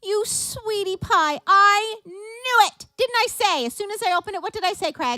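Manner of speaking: real high, loud squeal of delight; thrilled surprise. Real, not acted. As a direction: exclaim as if a mystery is solved at last. Learns who sent the gift.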